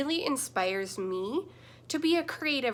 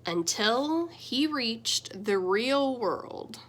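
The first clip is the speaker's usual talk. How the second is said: storybook reading. A narrator's reading. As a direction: read it like bedtime story time with little ones. Reads picture book aloud.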